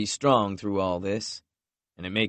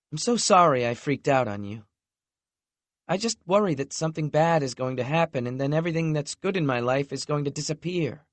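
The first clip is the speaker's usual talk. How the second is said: higher, quicker voice for a fretting woman; a hint of an anxious girlfriend; lightly voiced young woman, worried; 6 semitones higher than usual. A narrator's reading. Lexi apologizing to Knox, lightly voiced and worried.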